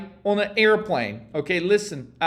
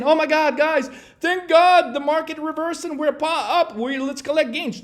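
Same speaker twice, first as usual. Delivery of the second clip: higher, mock-giddy relieved trader; 6 semitones higher than usual. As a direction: parody a trader who cashes out early. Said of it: "We're not saying".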